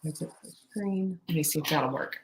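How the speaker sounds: low, very soft, distracted mumble; preoccupied thinking aloud; muttering to self. Real, not acted. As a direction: trail along under your breath while you troubleshoot. Still troubleshooting the screen share.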